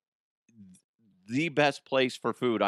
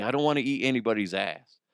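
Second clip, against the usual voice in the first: ordinary talk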